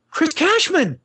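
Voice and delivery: high excited call across the airport; fan calling his name. Retold or imitated. Fan spots the game host at the airport, high excited call. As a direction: shout his name, thrilled to spot him. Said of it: "and this girl goes".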